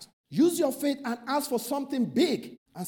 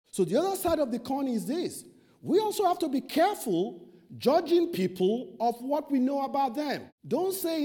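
normal talking voice

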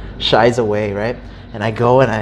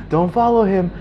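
urgent warning; 7 semitones higher than usual. Retold or imitated. Shopkeepers keep warning him.

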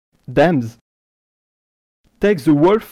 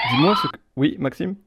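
ordinary talk, nothing marked

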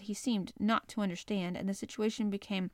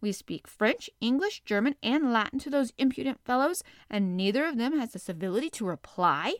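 angry rant; 3 semitones higher, 4 dB louder than usual. Acted. Ned ranting to the professor about the silent strangers, angry. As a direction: vent fury at the rude fellows. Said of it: "burst into a rage".

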